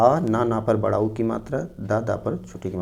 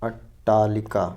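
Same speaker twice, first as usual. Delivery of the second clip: slow dictation; much slower than usual. Shown as a demonstration. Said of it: "नवा शब्द है।".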